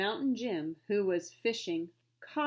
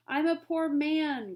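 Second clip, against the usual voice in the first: higher, louder, slow groan; 7 semitones higher, 6 dB louder, slower than usual. Acted. Trader groaning about his lost money, slow loud lament. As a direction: drag it out in misery. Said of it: "groaned the Yankee trader".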